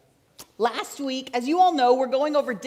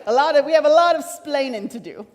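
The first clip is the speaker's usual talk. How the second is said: louder, hammed-up Cuban accent; Ricky Ricardo accent; 3 semitones higher, 7 dB louder than usual. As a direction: commit fully to a comic Cuban accent. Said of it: "I don't think that was a good accent".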